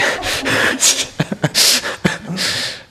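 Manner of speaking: high, breathless laugh; real laughter. Real, not acted. Teacher recalling the nun's praise, real high laughter. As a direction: laugh helplessly at your own story. Laughs at his own retold anecdote.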